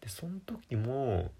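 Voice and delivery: usual speaking voice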